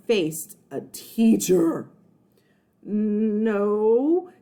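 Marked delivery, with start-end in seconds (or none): 2.8-4.3 stammering. Acted. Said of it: "he stammered".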